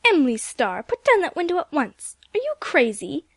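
Aunt Ruth snaps at Emily from the door; sharp exclamation. A narrator's reading. Aunt Ruth scolding Emily about the open window, loud exclaim. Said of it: "Aunt Ruth exclaimed".

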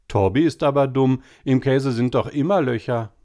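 mocking girls. A narrator's reading. Sisters mocking Tobi at the table, lightly childlike. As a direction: tease the little brother as silly.

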